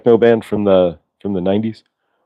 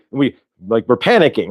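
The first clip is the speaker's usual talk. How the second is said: ordinary delivery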